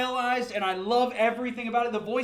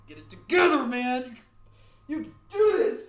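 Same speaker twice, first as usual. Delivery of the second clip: self pep talk; 2 semitones higher, 5 dB louder than usual. Real, not acted. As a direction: psych yourself up out loud.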